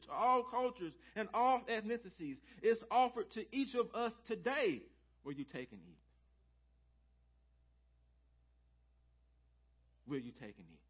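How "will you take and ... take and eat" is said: hushed invitation. Real, not acted. Quiet repeated invitation.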